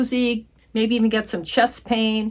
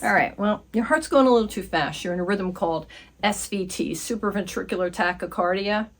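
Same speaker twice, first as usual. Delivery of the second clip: ordinary talk, nothing marked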